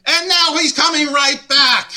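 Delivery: loud, high shouted punchline; exasperated yell. Real, not acted. Panelist griping that the wrestler returns, exasperated shout. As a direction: explode into a comic yell. Mock outrage after setting up praise.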